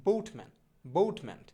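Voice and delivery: বোটম্যান with a gliding "bout" vowel, loud first beat; corrected pronunciation. Shown as a demonstration. Teacher drilling boatman with learners, বোটম্যান with "ou" glide. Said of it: "সঠিক উচ্চারণটা হবে হচ্ছে".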